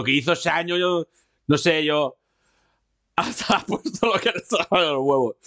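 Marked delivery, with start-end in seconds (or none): 2.7-5.4 talking through real laughter; laughing. Real, not acted. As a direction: laugh helplessly while getting the words out. Ri ao comentar o chat.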